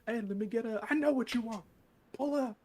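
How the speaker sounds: high, loud mock cashier scolding; drive-thru worker. Cashier scolding a regular customer, high and loud. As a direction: yell at the customer like a fed-up cashier.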